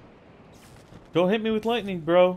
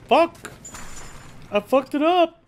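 higher, louder curse at the mistake; frustrated shout; 7 semitones higher, 4 dB louder than usual. Real, not acted. Streamer ruining his move, frustrated shout. Messes up in the game.

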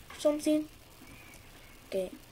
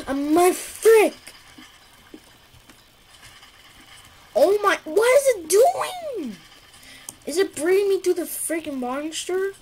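panicking gamer; 7 semitones higher, 5 dB louder than usual. Real, not acted. Chased by monster in game.

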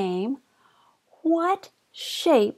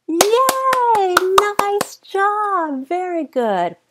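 excited praise; 8 semitones higher than usual. Real, not acted. Praising child's correct answer.

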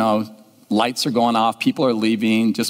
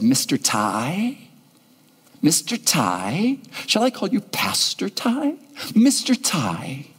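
slow, lightly voiced little girl; a child shyly trying out his name; 3 semitones higher, slower than usual. Girl at the stage greeting the pastor, slow and childlike. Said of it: "and she said".

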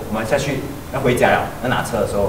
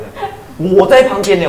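laughing; 6 semitones higher, 7 dB louder, much slower than usual. Real, not acted.

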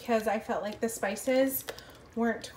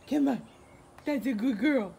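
normal talking voice

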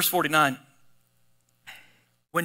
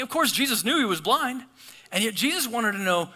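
normal talking voice

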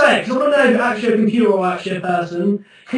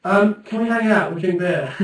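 ordinary talk

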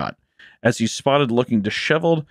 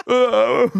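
mock cry; 13 semitones higher, 3 dB louder than usual. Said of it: "he's like".

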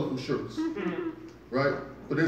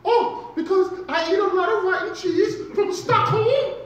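squeaky excited German fan; high German tourist voice; 5 dB louder than usual. Retold or imitated. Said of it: "Why do you talk like that with a high-pitched voice?".

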